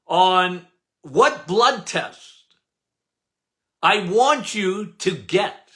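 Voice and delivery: ordinary talk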